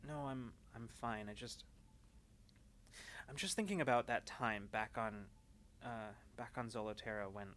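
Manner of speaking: soft, hesitant, halting voice; quiet confession. Acted. Alma confides her old fear to Bina, softly halting. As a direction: speak softly, searching for words.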